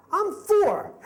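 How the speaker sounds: put-on tiny indignant four-year-old; little girl voice. Daughter correcting dad in a high, loud child voice. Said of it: "She's like".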